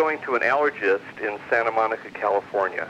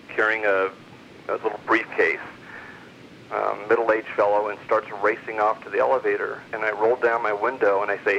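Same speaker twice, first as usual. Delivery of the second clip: ordinary talk, nothing marked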